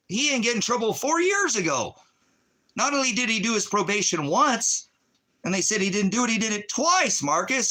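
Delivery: venting real fury, pitch climbing; angry rant. Real, not acted. Furious rant at Marcus about police.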